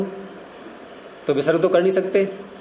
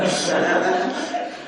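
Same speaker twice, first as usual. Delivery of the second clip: quiet laugh; 3 dB quieter than usual. Real, not acted. After the joke punchline.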